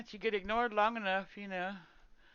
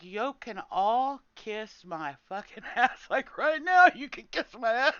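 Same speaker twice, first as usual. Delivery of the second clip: retold outburst; 3 semitones higher, 6 dB louder, slower than usual. Streamer replays telling raiders off, loud and angry. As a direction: tell them off, half laughing. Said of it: "I was like".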